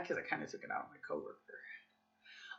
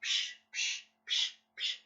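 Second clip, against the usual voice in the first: psh sound effect; 4 dB quieter, slower than usual. Retold or imitated. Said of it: "but it's like".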